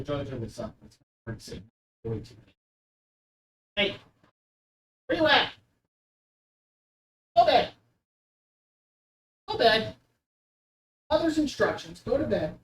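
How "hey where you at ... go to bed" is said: higher, soft coaxing; soft higher pet talk; coaxing the cat. Real, not acted. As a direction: gently coax the cat to bed.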